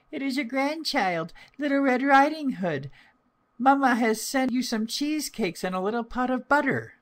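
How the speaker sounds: put-on very high, childlike voice; little-girl voice. A narrator's reading. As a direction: answer as a small girl, pitched right up. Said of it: "so she answered".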